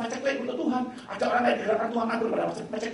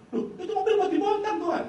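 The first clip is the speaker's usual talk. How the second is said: startled protest; 10 semitones higher, 5 dB louder than usual. Retold or imitated. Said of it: "Loh" protest to God".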